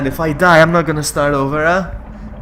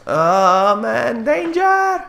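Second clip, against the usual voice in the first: slow glide climbing ever higher; drawn-out alarm; 5 semitones higher, 3 dB louder, much slower than usual. Real, not acted. Player noticing danger, rising drawn-out alarm. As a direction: stretch the words and slide steadily upward.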